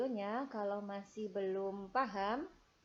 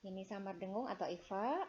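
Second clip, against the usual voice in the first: ordinary talk, nothing marked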